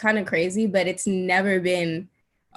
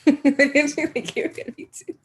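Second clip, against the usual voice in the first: laughing; 5 semitones higher than usual. Real, not acted. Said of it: "repeats question, laughing".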